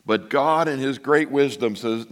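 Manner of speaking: usual speaking voice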